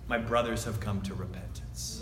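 almost breathe the words with awe; tender, quiet realization; hushed revelation. Acted. Hushed key line.

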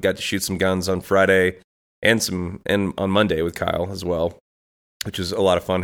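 usual speaking voice

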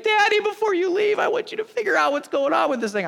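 put-on high-pitched child voice pleading with daddy; high, insistent little-girl imitation; imitated little daughter. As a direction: put on a small kid's demanding voice. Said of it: "she's like".

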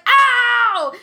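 high, loud yelp; startled pain shout. Retold or imitated. Mom reenacting her yell at the biting baby, pained shout. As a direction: shout it sharply to shock the baby. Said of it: "she was so startled".

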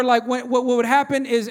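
normal talking voice

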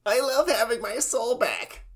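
put-on high whispery kid voice; cheerful little boy, high and breathy; Mokuba voice. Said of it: "Mokuba Reads nineteen eighty-four".

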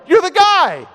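high-pitched exclamation of sudden realization; acted eureka outburst; dawning realization. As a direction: blurt it out, then stretch it bigger. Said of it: "it dawns on him".

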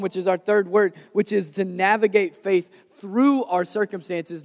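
ordinary talk

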